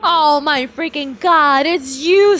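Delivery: normal talking voice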